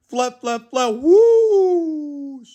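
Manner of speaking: rising, loud whoosh; flapping wings. A narrator's reading. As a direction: sweep up on the whoosh. Wings brush past.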